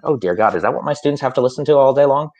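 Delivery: ordinary talk, nothing marked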